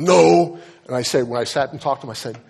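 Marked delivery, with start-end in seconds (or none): 0.0-0.6 loud, higher, drawn-out mock protest; emphatic group denial. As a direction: imitate a couple's emphatic refusal. Couple's collective answer, acted out.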